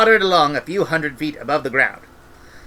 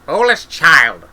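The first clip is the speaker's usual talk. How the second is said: scolding; 3 semitones higher, 8 dB louder, slower than usual. Acted. Granny scolds Esk.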